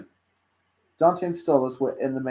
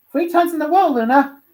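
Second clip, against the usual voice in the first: put-on high boy voice; 8 dB louder than usual. A narrator's reading.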